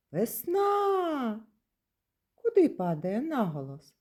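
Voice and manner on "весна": "Весна-а" as a high, long call. Shown as a demonstration. Teacher calls the word to find its stress, calling "Весна" out. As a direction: call "Весна" out, high and drawn-out on the last syllable. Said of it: "покличемо".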